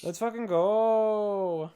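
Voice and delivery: drawn-out party cheer; rally everyone with a stretched cheer; hyped up. Pretend. Mock hype about getting high.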